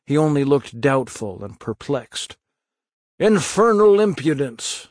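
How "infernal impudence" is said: scornful bystander. Acted. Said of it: "said a bystander".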